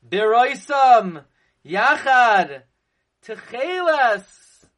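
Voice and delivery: slow, loud BIROISAM YACHAD TECHELAS, each word set apart; loud, drawn-out "Yachad" and "Techelas" with pauses between; emphatic proclamation. Real, not acted. That's the emphasis.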